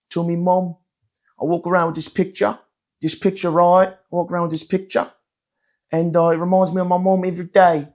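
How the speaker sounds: high-pitched mock British accent; Prince Harry. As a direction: put on a broad, cheeky English accent. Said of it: "pretended to be Prince Harry on stage".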